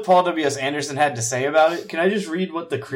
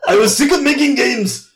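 put-on higher, louder Japanese accent broken by laughter; mock Japanese accent; 8 semitones higher, 3 dB louder than usual. Host reading Mikami's quote to co-host, in a mock Japanese accent. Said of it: "can you do a Japanese voice".

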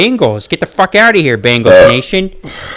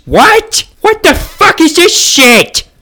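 very high, incredulous outburst; stunned fan reeling at the score; shocked outrage; 12 semitones higher, slower than usual. Acted. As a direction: shriek in disbelief, then drag out the outrage. Shown that his team lost.

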